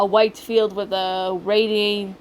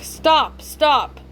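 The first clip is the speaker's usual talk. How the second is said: higher, stern call to halt; a hidden voice orders him to stop; commanding shout; 6 semitones higher than usual. A narrator's reading. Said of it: "a strange voice from the tornado".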